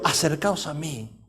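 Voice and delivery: low, slow, hushed invitation; softly said. Acted. Elijah calling all the people closer, softly. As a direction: drop right down and draw it out gently. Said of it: "Se lo dijo despacito".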